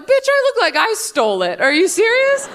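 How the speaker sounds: high, disbelieving squawk; can't believe the question, pitch way up; incredulous. Retold or imitated. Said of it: "I'm like".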